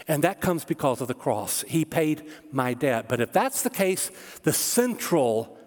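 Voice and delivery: ordinary talk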